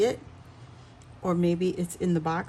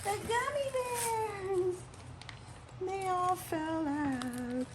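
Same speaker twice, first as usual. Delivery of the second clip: high, slow wail of dismay; oh no, they've spilled everywhere; a high cry as the candy pours out; 12 semitones higher, 7 dB quieter, slower than usual. Real, not acted. Gummies spilled out of the package.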